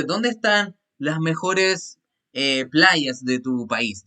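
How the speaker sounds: slow, deliberate reading; teacher slowly translating for students; slow translation. Shown as a demonstration. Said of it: "teacher translates slowly".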